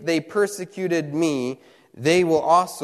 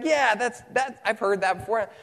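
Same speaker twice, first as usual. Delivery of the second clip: lightly voiced friend, higher; a hint of the friend answering, pitched up; preacher retelling his friend's casual reply, mildly higher; 5 semitones higher than usual.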